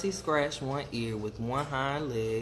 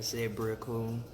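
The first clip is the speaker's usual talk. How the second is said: ordinary delivery, nothing marked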